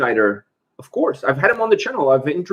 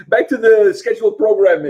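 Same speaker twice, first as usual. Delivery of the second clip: laughing; 8 semitones higher, 4 dB louder than usual. Real, not acted. Giggling after awkward guitar question.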